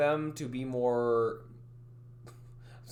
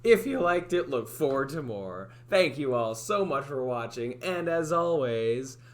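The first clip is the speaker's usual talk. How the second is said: 1 stream outro; 5 dB louder, slower than usual. Shown as a demonstration. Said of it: "I'll try that again".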